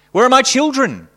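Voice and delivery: high, loud frantic plea; desperate cry. Acted. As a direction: cry out in panic for the children. Desperate man calls in darkness.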